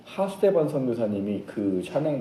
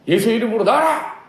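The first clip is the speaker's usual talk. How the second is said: loud, high cry climbing at the end; commanding shout; 10 semitones higher, 6 dB louder than usual. Acted. Disciples retrying in Jesus' name, commanding shout. Acting disciples' second attempt.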